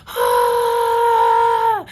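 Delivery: high, loud, sustained scream; acted-out scream. New mother retelling the pushing, high loud mock scream. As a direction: reenact a long labor scream. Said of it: "Don't scream".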